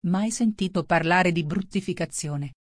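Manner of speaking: ordinary delivery, nothing marked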